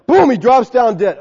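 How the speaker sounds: ordinary delivery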